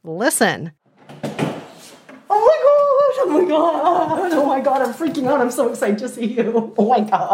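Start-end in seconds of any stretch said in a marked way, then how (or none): 2.3-7.3 real high-pitched, gushing excitement with laughter; overwhelmed, laughing and freaking out. Real, not acted. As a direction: squeal with delight at seeing a friend. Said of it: "overwhelmed Mile a Minute [name]".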